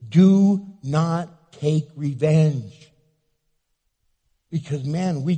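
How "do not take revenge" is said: very slow, louder, each word stressed; slow, firm warning; emphatic command. Real, not acted. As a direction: hammer every word home. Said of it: "Listen to me".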